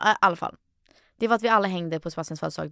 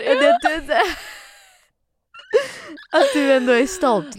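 crying, louder and higher; tearful; 7 semitones higher, 6 dB louder than usual. Real, not acted. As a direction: choke up while asking. Emotional, crying while laughing.

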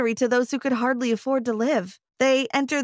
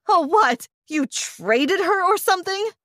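angry outcry; 7 semitones higher than usual. Acted. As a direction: cry out in disbelief at the parents. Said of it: "I cried".

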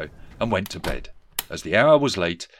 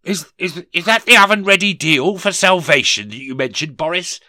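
high, stammering, alarmed question; faltering demand; 10 semitones higher, 8 dB louder than usual. Acted. Dismayed Scrooge questions the deal, high and faltering. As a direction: stumble into it, then demand loudly. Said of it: "demanded in a faltering voice".

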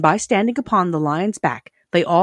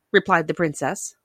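plain reading voice

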